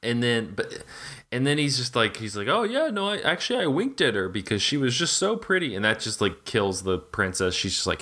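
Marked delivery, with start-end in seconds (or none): 2.5-5.8 higher, breezy flirt voice, lightly put on; smug charmer. Chevalier explaining his wink to the king, lightly voiced smug charmer. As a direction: brush it off like a cocky ladies' man.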